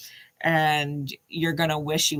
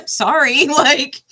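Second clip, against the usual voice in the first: laughing apology; 7 semitones higher, 9 dB louder, slower than usual. Real, not acted. Laughing after crude mantra.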